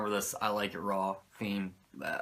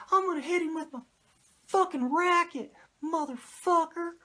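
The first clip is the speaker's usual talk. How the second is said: much higher squeaky imitation of a manager; Cornette's high-pitched voice. Cornette plans the beatdown in a high-pitched mock voice. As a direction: ramble the plan in a strained falsetto. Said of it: "Cornette with his fucking high-pitched voice".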